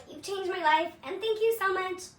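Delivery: high sweet thank-you of adoring fans; grateful girls' voice. Retold or imitated. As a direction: lift it up high like starstruck young girls. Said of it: "these girls are like".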